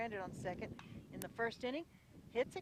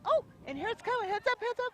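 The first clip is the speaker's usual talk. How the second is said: much higher, louder alarmed yell; warning shout; 11 dB louder than usual. Real, not acted. Announcer warning fans of a foul ball, alarmed shout.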